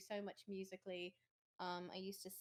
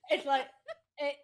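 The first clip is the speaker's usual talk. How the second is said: ordinary talk, nothing marked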